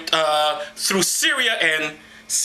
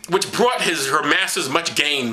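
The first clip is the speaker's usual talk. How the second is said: ordinary delivery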